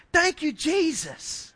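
put-on high, slow little boy's thanks; a tiny boy drawing out his thanks; grateful child. Small boy thanking Jesus for tickets, high slow child voice.

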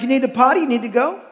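sing-song potty question to the dog; dog baby talk. Retold or imitated. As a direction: coax the dog like a toddler. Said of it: "I'm like".

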